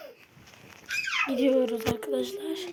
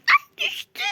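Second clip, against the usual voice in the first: startled squeal; 6 dB louder than usual. Real, not acted. Falls in game.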